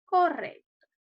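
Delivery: ordinary delivery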